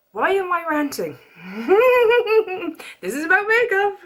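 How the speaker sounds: high, loud laughter breaking through; high-pitched giggling self-mockery; laughing at herself. Real, not acted. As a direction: laugh off your own rant.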